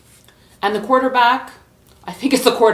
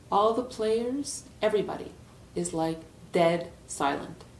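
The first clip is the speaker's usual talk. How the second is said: hushed suspense narration; 3 semitones lower, 7 dB quieter than usual. Acted. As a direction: let each step land quietly. Suspenseful hush in the story.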